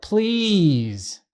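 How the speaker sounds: put-on "Please." drawn out high like a small child; wheedling please. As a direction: coax with one long sweet "Please.". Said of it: "the tone and the emphasis on the word please".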